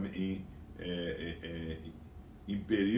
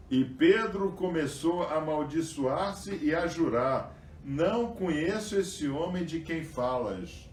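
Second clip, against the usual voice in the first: raised, louder Bible reading, slow on the quotes; scripture reading; 6 semitones higher, 3 dB louder than usual. Acted. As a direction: read the passage out to the congregation. Rereads the scripture passage. (leitura bíblica em voz alta e elevada)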